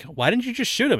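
high-pitched mock bafflement; imitated incredulous question. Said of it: "And everybody's like".

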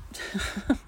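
quick laugh at a flubbed line; short real laugh. Real, not acted. Reader stumbling on narration, short real laugh. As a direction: chuckle at the mistake.

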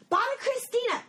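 BOBBY CHRISTINA in a high, hammed-up cry; exaggerated name call. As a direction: shoot the pitch way up on Bobby Christina.

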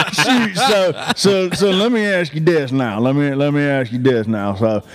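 put-on country boy voice. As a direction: ham up a country boy, laughing through it. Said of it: "I'm gonna be like".

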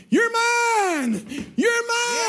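high crying shout; tearful cry. Acted. Boy clutching his lost sailboat, crying out high.

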